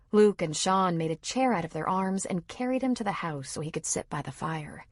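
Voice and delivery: ordinary delivery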